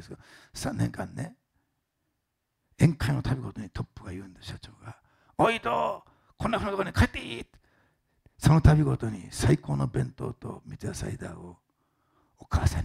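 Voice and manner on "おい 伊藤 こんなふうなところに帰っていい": calling out. Retold or imitated. President at the banquet calling out to Ito. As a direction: call across the room to Ito like the boss.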